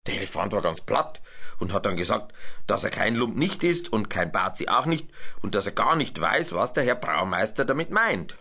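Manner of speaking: plain reading voice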